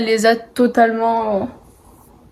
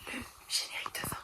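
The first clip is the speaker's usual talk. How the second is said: whispering; 24 dB quieter, faster than usual. Real, not acted. Sign-off aside.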